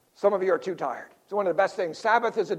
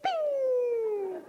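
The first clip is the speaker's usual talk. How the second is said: mock victory ding; 4 dB quieter than usual. Jesus's instant victory sound.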